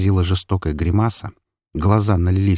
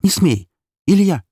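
high, loud woman's shout; alarmed cry; 11 semitones higher, 5 dB louder than usual. A narrator's reading. Mother stopping her husband, high alarmed cry. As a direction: cry out to stop an attacker. Mother cries out to stop father.

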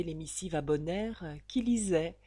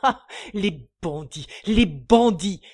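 building to a huge, strained shout; outraged father crying out against bandits; cry of outrage; 12 dB louder than usual. Acted. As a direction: howl it at the sky, arms raised. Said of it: "il eut ce cri sublime".

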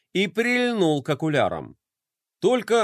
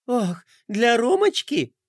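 high, slow squeal of delight; 8 semitones higher, slower than usual. Acted. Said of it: "Пинки запищала от восторга".

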